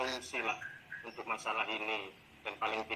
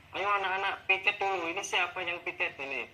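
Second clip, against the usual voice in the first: higher-pitched mock scolding of pupils; retold pre-class telling-off, pitched up; nagging teacher; 6 semitones higher than usual. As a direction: imitate yourself nagging the class to do chores. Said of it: "saya harus ngomel-ngomel dulu sebelum masuk kelas".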